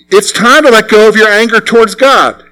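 usual speaking voice

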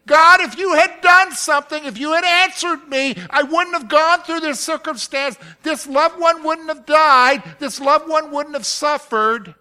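high, loud accusing lament; bitter complaint to God. Retold or imitated. Grieving believer accusing God, loud and high. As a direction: blame God for the loss. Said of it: "haven't you said those kind of things?".

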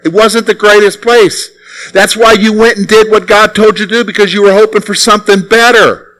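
normal talking voice

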